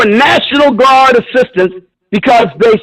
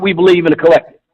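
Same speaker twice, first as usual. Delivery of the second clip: mocking Kamala Harris; 6 semitones lower, faster than usual. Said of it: "talking about".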